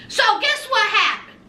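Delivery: ordinary talk, nothing marked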